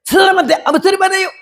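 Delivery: high, fast, dismissive; son siding with wife. Retold or imitated. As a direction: brush mother off in a high quick voice. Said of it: "어머니 보고".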